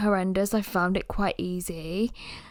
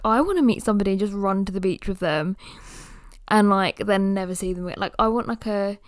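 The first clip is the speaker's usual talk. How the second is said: usual speaking voice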